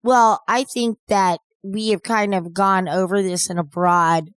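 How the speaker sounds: ordinary delivery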